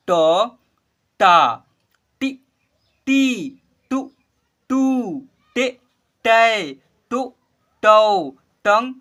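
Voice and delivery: slow, loud, drawn-out syllable recitation; syllable drill. Shown as a demonstration. Teacher leads class in reciting barahkhadi, slow and loud. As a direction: call out each syllable clearly for the class to repeat. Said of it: "यहाँ पर ध्यान दीजिएगा".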